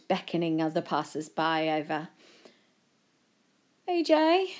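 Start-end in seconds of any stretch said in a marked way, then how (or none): 3.9-4.5 high-pitched nervous appeal. A narrator's reading. Joe nervously turning to his friend, pitched high. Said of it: "Joe said nervously".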